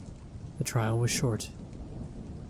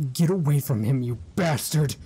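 loud, higher, furious yell; furious shout; 4 semitones higher, 5 dB louder than usual. Acted. Mother screaming at the killer clown, furious. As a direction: attack the monster with your voice. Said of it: "I snapped".

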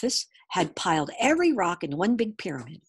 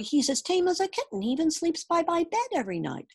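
put-on high, eager young boy voice; pleading boy; 9 semitones higher than usual. A narrator's reading. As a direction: plead for the dragon like a child.

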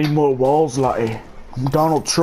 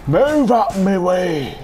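higher, slow put-on accent holler; drawn-out call; 4 semitones higher, slower than usual. Gamer ordering others out of his way, drawn-out call. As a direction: stretch it out in a hammed-up accent. Barging past in game.

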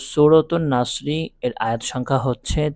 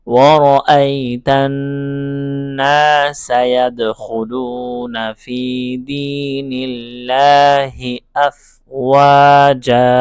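words flowing together in slow chant; connected, slow Quranic chant; joined recitation; 3 dB louder, much slower than usual. Shown as a demonstration. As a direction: recite the phrase as one smooth melodic line. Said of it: "একসাথে যদি পড়ি".

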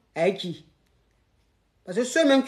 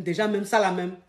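normal talking voice

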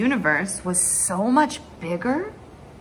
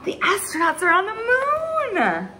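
excited cry; 9 semitones higher than usual. Acted. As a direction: shout it out like a thrilled child. Crowd cheering at TV.

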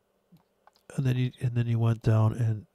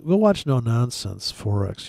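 ordinary delivery

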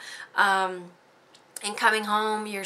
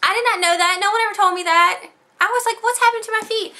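much higher and louder, disbelieving; astonished outburst; 13 semitones higher, 8 dB louder, faster than usual. Real, not acted. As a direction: let the amazement burst out. Shocked discovery about her feet.

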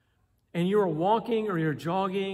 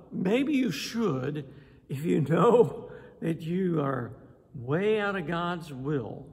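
normal talking voice

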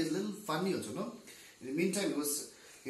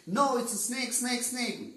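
high-pitched repeated contradiction; arguing loudly; 8 semitones higher, 4 dB louder than usual. Acted. Another blind man shouting back his answer, arguing loudly. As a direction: contradict and keep repeating, voice raised. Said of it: "shout grew louder and louder".

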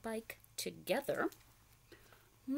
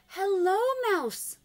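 much higher, louder greeting; Duck calling hello; 10 semitones higher, 9 dB louder, slower than usual. Acted. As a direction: call out as Duck. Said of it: "said Duck".

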